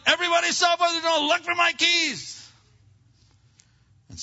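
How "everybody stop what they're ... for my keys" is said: high, furious shout at the household; acted enraged parent shouting commands; yelling. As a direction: yell fast, then stretch out the final order. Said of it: "And so you're yelling".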